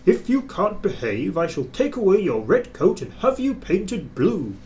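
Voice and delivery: a stern warning; a severe boss scolding a naughty engine. A narrator's reading. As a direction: speak down to him firmly. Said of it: "spoke severely to James".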